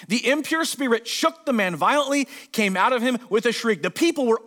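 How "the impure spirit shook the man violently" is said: ordinary talk, nothing marked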